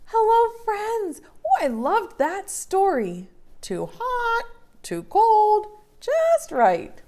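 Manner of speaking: very high, cheery puppet voice; high bouncy puppet friend. Acted. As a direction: squeak like a happy little puppet. Puppet Betsy speaks.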